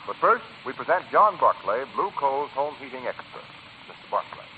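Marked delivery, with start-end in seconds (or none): none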